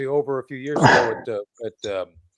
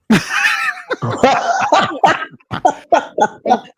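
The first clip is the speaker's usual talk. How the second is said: hearty shouting laugh; loud laughter; 12 semitones higher, 4 dB louder than usual. Real, not acted. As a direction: burst out laughing loudly. Big laugh.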